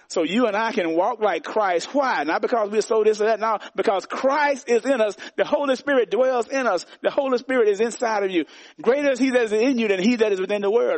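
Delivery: high, driving sermon climax; preaching at full force. Acted. Pastor preaching the Holy Spirit to believers, driving and high. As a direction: build it up and drive it home.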